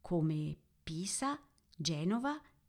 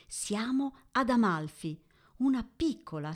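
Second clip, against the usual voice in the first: normal talking voice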